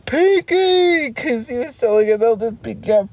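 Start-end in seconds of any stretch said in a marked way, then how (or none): 0.0-1.1 higher, put-on cartoon voice; cartoon character voice. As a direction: call "Pinky" in a squeaky cartoon voice. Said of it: "he's like".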